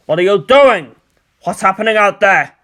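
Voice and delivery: loud, demanding questions; angry demand. A narrator's reading. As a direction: press him hard for answers. General confronts villain angrily.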